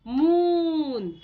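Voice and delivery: मून slow with a drawn oo; मून said slowly, long oo. Shown as a demonstration. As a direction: hold the oo in मून. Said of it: "बड़े ऊ की मात्रा".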